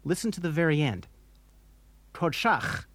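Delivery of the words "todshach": "Todshach" ending in a throaty Bach-like ch; rasped final ch. Shown as a demonstration. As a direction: force air through the ch of "Todshach". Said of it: "The last sound in the word is ch".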